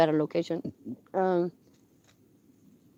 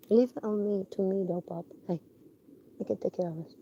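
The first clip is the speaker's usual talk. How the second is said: much softer, reassuring the dog; soft reassurance; 5 semitones lower, 12 dB quieter than usual. Real, not acted. Owner comforting her alert dog, softly reassuring. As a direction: hush and calm the dog down.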